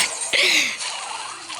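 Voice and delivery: short airy laugh under the breath; breathy laugh. Real, not acted. Amused gamer laughing softly at the spinning players. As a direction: let a quiet laugh slip out.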